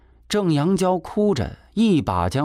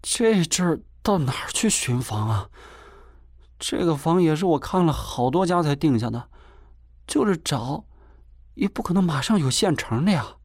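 aggrieved, high-pitched protest; 7 semitones higher than usual. A narrator's reading. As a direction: plead your case as if wronged, pitch up. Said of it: "贾秀不无委屈地说". (音调偏高，带着委屈辩解)

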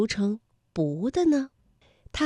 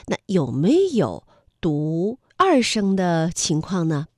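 ordinary talk, nothing marked

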